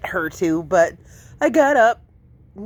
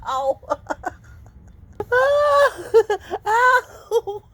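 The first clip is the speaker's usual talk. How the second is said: high laughing ow; laughing yelp of pain; 11 semitones higher than usual. Real, not acted. Kitten biting her.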